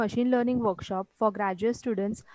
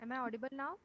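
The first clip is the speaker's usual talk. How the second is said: barely audible; 7 dB quieter than usual. Real, not acted. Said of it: "little bit okay".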